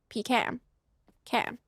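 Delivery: "Pecan" ending just like the word "can"; "Pecan" with a long EE and the A of "cat"; PEE-can pecan. Shown as a demonstration. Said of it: "I can do this".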